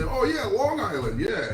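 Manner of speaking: high-pitched swooping "oh, yeah" of false recognition; mock recognition. Stranger reacting to mention of Rhode Island, mock knowing. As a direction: imitate someone pretending to know the place. Retelling people confusing his state.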